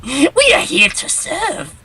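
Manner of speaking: high, fawning cultist; heretic voice. Retold or imitated. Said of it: "the voice they had for the heretics".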